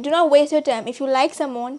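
ordinary talk, nothing marked

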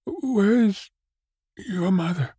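high, halting question; hesitant, high-pitched and broken up; faltering voice. A narrator's reading. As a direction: falter between the words, afraid to ask. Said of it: "with a faltering voice".

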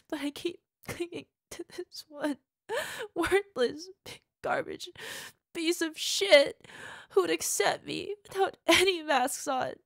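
loud, high sobbing rant; crying rant. Acted. Partner breaking down over clinging to her lover, crying. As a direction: let it break into tears.

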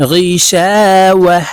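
غيشاوة chanted slowly, ending 'wah' on a breathed h; stop on silent ha. Shown as a demonstration. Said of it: "হাকে সাকিন করে ফেলব". (غيشاوة-র শেষে হা সাকিন করে থামা)

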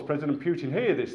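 ordinary talk, nothing marked

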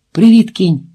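a loud, high, eager hello; sudden burst of cheer at seeing him; bright greeting. Acted. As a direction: call out the greeting with delight.

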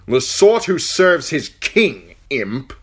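high, loud, slow sneer; contemptuous knight. Acted. Knight answering the dwarf, loud and contemptuous. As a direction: sneer it down at him.

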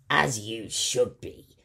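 satisfied pirate threat; menacing pirate. Acted. Pirate captain speaks.

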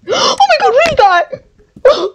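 high, slow horrified cry; frightened gasp. Real, not acted. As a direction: scream it out in fright. Jump scare in horror game.

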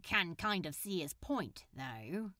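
low, soft muttered aside; mumbled quiet admission. Acted. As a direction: say it under your breath.

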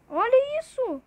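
high, loud exclamation of disbelief; shocked outcry. Real, not acted. Streamer showing viewers an unbelievable play, shocked outcry. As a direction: blurt it out, stunned by what you see.

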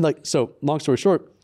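ordinary talk

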